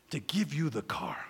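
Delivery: whispered, hushed reveal; awed whisper. Retold or imitated. Telling the congregation of the gift, awed whisper. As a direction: breathe it out like a secret.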